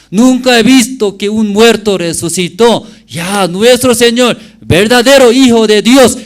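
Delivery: high, loud fervent preaching; preaching at full force. Real, not acted. Pastor preaching to the brothers, fervent and loud. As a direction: proclaim the resurrection with fire.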